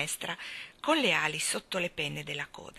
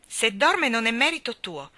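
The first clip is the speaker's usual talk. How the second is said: louder, pitched-up comeback; brisk rebuff, voice raised a little; sharp retort; 5 semitones higher, 7 dB louder than usual. Acted. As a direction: answer back firmly and put them down. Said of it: "lo rimbeccò Mary Poppins".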